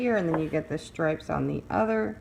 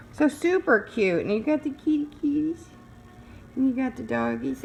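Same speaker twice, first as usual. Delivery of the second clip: cooing; 7 semitones higher than usual. Real, not acted. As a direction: coo at the kitty pictures. Cooing over cute cats.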